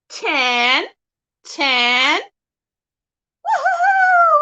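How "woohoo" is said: excited cheer. Real, not acted. Praising parrot for counting.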